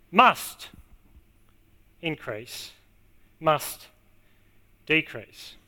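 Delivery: "Must" set apart by pauses, "increase" and "decrease" dropped low; stress on MUST. Shown as a demonstration. Preacher insists it is not an option, stressing "must". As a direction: punch "must", then let the next word sink. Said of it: "I'll put the emphasis on the right word here".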